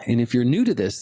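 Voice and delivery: usual speaking voice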